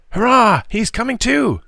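put-on high-pitched boyish hurrah; excited cry. A narrator's reading. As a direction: cry out with joyful relief. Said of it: "cried Willie Ruthers".

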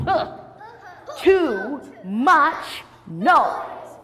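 loud, very slow drawn-out complaint; grumpy old man. Acted. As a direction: stretch every word in annoyance. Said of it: "And he said".

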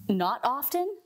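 normal talking voice

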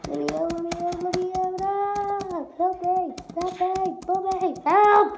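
high desperate cries; panicked pleading. Real, not acted. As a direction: scream for help, terrified. Trapped, begging to escape.

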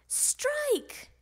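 high, drawn-out exclamation; high-pitched bowling cheer; exultant call. A narrator's reading. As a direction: call the strike high and long. Grandma's bowling strike called out.

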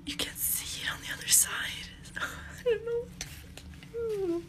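high, soft, crying whisper; crying whisper of despair; whispered sobbing. Real, not acted. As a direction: breathe it out in tears.

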